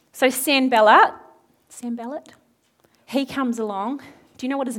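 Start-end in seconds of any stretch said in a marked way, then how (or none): 1.7-2.3 quiet repeat. Real, not acted. Preacher introducing the opponent, quietly repeating "Sanballat".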